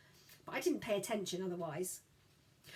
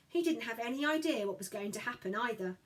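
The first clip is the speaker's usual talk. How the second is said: plain reading voice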